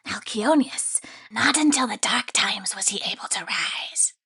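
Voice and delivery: sinister whispering creature voice; creepy grain spirit whisper. Acted.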